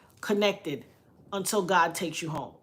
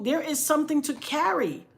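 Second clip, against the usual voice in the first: usual speaking voice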